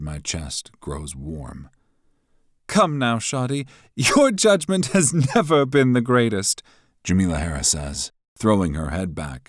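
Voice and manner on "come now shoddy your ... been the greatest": high, loud, taunting woman's voice; mocking rival. A narrator's reading. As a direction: needle him with scorn.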